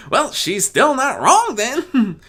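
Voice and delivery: slow, loud high teasing filly voice. Acted. Apple Bloom teasing Skulu, high and slow. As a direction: drag it out to needle her. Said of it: "Apple Bloom teased".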